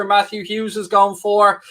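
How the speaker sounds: normal talking voice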